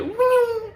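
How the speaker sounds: very high vocal "woo" effect; high whoosh. Retold or imitated. Storyteller imitating hero boosting himself, high whooping noise. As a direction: whoop like someone rocketing off.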